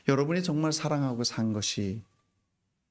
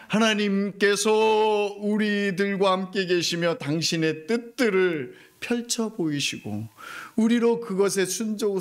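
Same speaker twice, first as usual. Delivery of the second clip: full-force preaching; 7 semitones higher, 3 dB louder than usual. Acted. Pastor proclaiming God's presence to the church. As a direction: proclaim it to the rafters.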